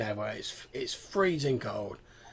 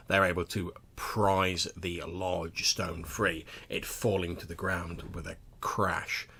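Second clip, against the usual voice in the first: lower, steady narrator read; game master narration; 4 semitones lower than usual. Acted. Game master narrating the trek to the mound, measured. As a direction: set the scene, slow and measured.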